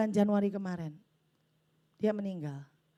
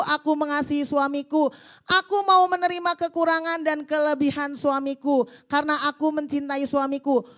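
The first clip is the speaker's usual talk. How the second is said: loud, impassioned prayer voice read to the congregation; fervent prayer reading; 6 semitones higher, 8 dB louder, slower than usual. Acted. Preacher reading a wife's prayer aloud, fervent and loud. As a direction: cry out the prayer like a battle cry.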